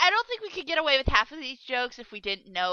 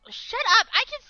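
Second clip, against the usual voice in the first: playful shout; 10 semitones higher than usual. Real, not acted. Playful retort to teasing.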